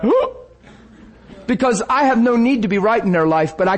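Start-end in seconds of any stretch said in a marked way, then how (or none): 0.0-0.4 high mock gasp whoop; startled whoop. People at the table reacting, high startled whoop. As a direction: jump back startled.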